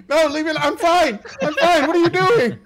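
high, loud flustered voice; panicked nurse. Acted. Nurse refusing Rupert's help, high panicky voice. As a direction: back away in a panic. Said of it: "It's just like".

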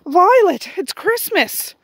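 high, loud delighted gasp of relief, the last words slowed; excited exclaiming. Retold or imitated. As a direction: burst out with joyful relief, then stretch out "it's Christmas.". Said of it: "I'm like".

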